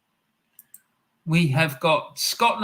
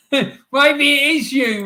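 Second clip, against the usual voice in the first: laughing through the words, pitched up high; 9 semitones higher, 5 dB louder, slower than usual. Real, not acted. To Del Boy in chat, laughing at the lookalike joke. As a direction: laugh it out, slow and amused.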